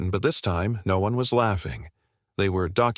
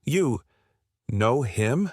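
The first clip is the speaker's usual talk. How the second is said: nervous, cracking question; 4 semitones higher, slower than usual. A narrator's reading. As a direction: pitch jumps up, voice cracking on "You". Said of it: "Blackwell's voice cracked".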